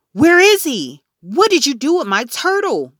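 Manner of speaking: loud, high furious demand; yelled. A narrator's reading. Peter yelling at Fudge about his missing turtle, loud. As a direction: yell at the little brother who took the turtle. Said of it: "I yelled".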